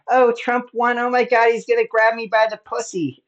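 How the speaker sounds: high, loud mock panic; freaking out, imitated. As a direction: mimic a woman in a hammed-up panic. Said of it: "freaking out".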